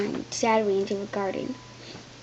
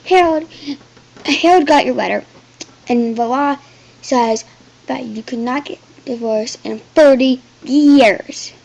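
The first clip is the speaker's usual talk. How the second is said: loud snarling messenger; 2 semitones higher, 9 dB louder than usual. Acted. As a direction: snarl the news at her. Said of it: "The man snarled".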